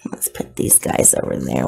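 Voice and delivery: soft whispered aside; hushed, breathy under-the-breath remark. Real, not acted. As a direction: whisper it to yourself.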